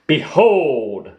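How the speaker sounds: grand, showy reveal; proud announcement. Acted. Tigger shows off his trap, proud announcement. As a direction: present it like a showman. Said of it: "cried Tigger proudly".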